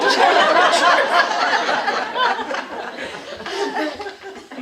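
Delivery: loud, high hearty laugh; big burst of laughter. Real, not acted. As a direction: laugh out loud, falling away. Speaker keeps laughing.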